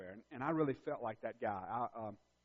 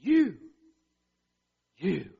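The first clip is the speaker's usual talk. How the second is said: emphatic calling out; pointed emphasis; 11 dB louder than usual. Retold or imitated. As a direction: point at them with the word. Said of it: "Jesus is pointing His finger and He's saying".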